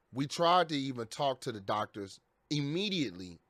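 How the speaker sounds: usual speaking voice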